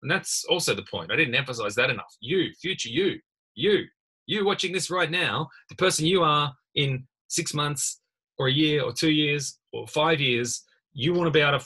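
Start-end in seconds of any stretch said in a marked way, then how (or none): 2.2-9.6 higher, slow, leaning on each you; emphatic. Real, not acted. Tutor urging students to keep notes, emphatic. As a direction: press every you at the viewer. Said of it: "if that's not emphatic enough".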